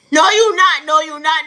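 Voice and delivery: loud refusal. Acted. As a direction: bark the refusal. Rejecting the driver's prayer threat.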